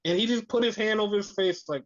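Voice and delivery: usual speaking voice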